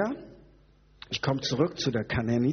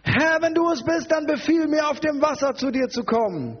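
high, projected plea; hammed-up calling out; 12 semitones higher, 3 dB louder than usual. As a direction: call it out theatrically over the waves. Acts Petrus calling to Jesus. (theatralisch hohes, lautes Rufen)

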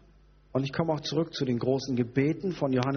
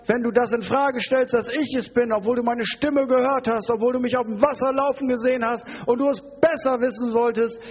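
high, raised-voice rebuke; indignant scolding; 9 semitones higher, 3 dB louder than usual. Acted. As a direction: pile up the reproaches in mock outrage. Indignant scolding in Jesus' place. (empört mit erhobener Stimme schimpfen)

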